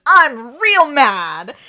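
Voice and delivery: loud, slow, drawn-out anger; melodramatically furious; angry outburst. A narrator's reading. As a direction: stretch it out as a hammed-up shout. Link's angry declaration to camera.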